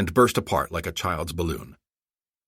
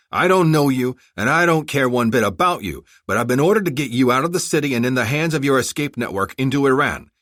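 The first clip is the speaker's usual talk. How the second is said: stern ultimatum, raised and forceful; menacing, angry; 6 semitones higher, 4 dB louder than usual. A narrator's reading. Armored rescuer warning the bodyguards to choose, angry menace. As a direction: lay down the terms hard, pitched up. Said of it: "menacingly to emphasize his point".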